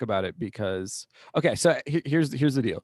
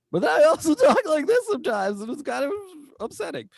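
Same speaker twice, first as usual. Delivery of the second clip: high voice; 5 dB louder, faster than usual. Shown as a demonstration. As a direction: voice jumps much higher with a laugh. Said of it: "two pitches to my voice".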